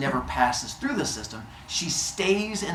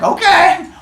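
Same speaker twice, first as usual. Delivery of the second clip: chirpy okay; 12 dB louder than usual. Retold or imitated. Hephaestus after whack.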